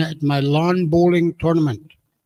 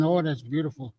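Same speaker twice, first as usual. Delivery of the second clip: ordinary delivery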